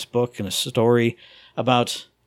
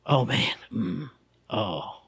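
mock weepy; 4 semitones lower, 8 dB quieter, much slower than usual. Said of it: "going from one extreme ... to".

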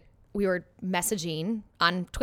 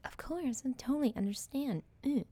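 high, soft, accommodating voice; mock agreeable; 5 semitones higher, 7 dB quieter than usual. Quoting herself to a noncommittal boyfriend, mock agreeable. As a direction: imitate being understanding, small and pitched up. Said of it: "I was always just like".

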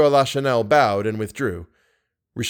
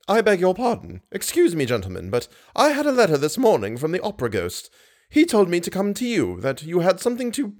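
higher-voiced, flustered apology; hurried; 7 semitones higher than usual. A narrator's reading. Box keeper brings managers a letter, hurried. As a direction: get the errand out urgently. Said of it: "and said hurriedly".

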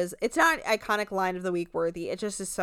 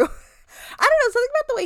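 can't stop giggling through the words; laughing; 6 dB louder, faster than usual. Real, not acted. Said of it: "really cracked me up".

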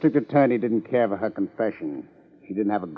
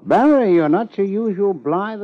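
loud call; 11 semitones higher, 7 dB louder than usual. Acted. Friend greets detective teasingly.

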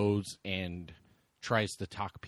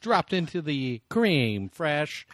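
higher-pitched hammed-up order, Spanish accent on the dish; mock Spanish accent switch; 5 semitones higher, 6 dB louder than usual. Joking diner placing a crude order, mock Spanish accent. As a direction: draw out the silly order, then overdo the Spanish accent. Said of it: "go into a whole nother voice".

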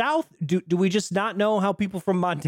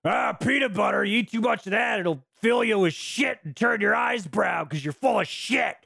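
a higher, shouty imitation of a crusty father scolding; Mel Gibson's gruff dad; 6 semitones higher than usual. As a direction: bark the lecture at the kid like a cranky old dad.

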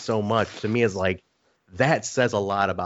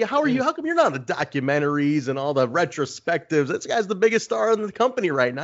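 pitched way up, needling a friend; hammed-up teasing, higher; ribbing high voice; 8 semitones higher than usual. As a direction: rib him in a high mock voice. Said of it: "I said".

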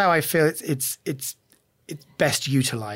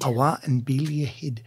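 quieter, lightly put-on Scottish accent; English host copying the Scottish guest; attempted Scottish; 3 semitones lower, 4 dB quieter than usual. Shown as a demonstration. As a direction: repeat the Scots phrase back, softly. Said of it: "I should do the accent".

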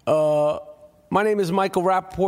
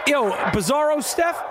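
host suddenly recognizing the caller; surprised shout; 8 semitones higher than usual. Real, not acted. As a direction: call out his name in surprise.

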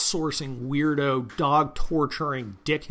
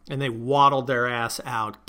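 ordinary talk, nothing marked

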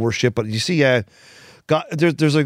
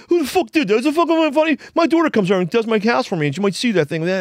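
a much higher, heated mock rant down the phone; retold angry rant; 8 semitones higher than usual. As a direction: rage at whoever planted it, imitating him. Friend's furious call retold.

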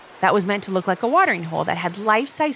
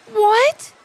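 high loud shocked cry; shocked exclaim; 14 semitones higher, 6 dB louder than usual. Acted. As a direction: gasp it out in alarm. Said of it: "Haven exclaimed".

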